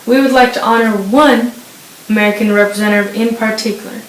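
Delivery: loud news read. Acted. Anchor honoring Jesse Owens, booming loud. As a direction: project it like a stadium announcer. Reading Olympics news segment.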